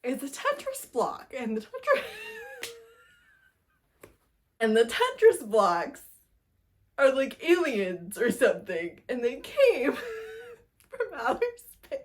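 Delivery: giggling through it, voice high and cracking; laughing. Real, not acted. Telling viewers about the alien Tetris blocks, laughing. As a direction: laugh helplessly through the silly plot. Laughing while explaining absurd plot.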